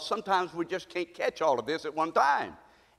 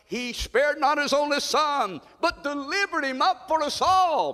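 fervent reading; 8 semitones higher than usual. Acted. Reading with fervour.

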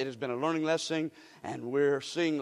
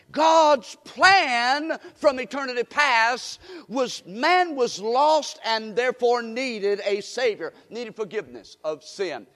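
rising, pressing sermon voice; preaching at full force; 4 semitones higher, 7 dB louder, slower than usual. Acted. Preacher pressing the church on God's plan, full force. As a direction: drive the point home to the congregation.

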